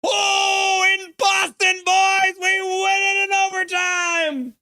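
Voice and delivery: real overtime-win joy, high and loud; breathless, lightheaded cheering; ecstatic victory shouting. Real, not acted. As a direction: yell it like your team just won in overtime. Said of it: "I'm so red in the face!".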